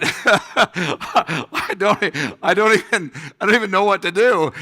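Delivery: loud, high-pitched comic helplessness; laughing mock panic. Replays his panic, laughing.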